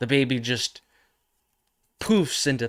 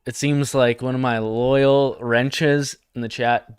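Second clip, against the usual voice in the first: ordinary talk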